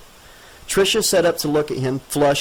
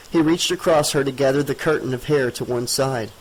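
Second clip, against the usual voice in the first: ordinary delivery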